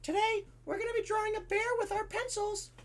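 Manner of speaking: high, put-on teacher voice. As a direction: announce it to the class in a high-pitched voice. Teacher voiced in classroom bit.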